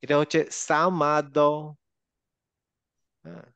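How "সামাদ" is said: slow "সামাদ" with a bounced final দ; qalqalah echo. Shown as a demonstration. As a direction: release the দ of "সামাদ" into a short vowel. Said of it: "একটা ইকো হবে".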